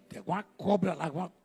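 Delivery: usual speaking voice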